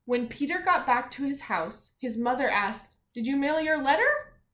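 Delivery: storybook reading with energy; story narration. A narrator's reading. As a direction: read it out for the kids. Reads story to children.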